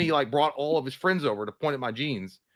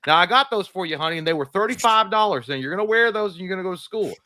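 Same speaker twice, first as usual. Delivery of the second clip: higher, firm mom voice; 6 semitones higher, 3 dB louder than usual. Retold or imitated. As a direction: mom laying down the law, lightly voiced. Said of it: "she's like".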